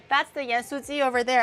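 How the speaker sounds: usual speaking voice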